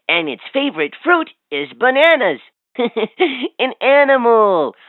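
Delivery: ordinary talk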